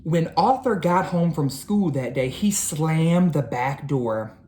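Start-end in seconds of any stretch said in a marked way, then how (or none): none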